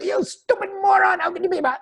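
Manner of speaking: very high, hammed-up angry squawk; mock freak-out. Dater berates waiter over wrong food, mock freak-out. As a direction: imitate a dater losing it in a squealing tantrum. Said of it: "you freak out".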